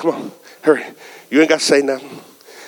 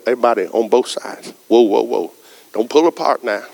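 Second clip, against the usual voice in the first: ordinary talk, nothing marked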